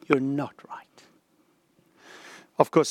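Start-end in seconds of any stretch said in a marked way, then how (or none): 0.1-0.9 whispered conscience; inner voice whisper. Retold or imitated. Preacher quoting his own conscience, a whisper. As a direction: breathe it like a quiet inner voice. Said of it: "I know what mine says to me".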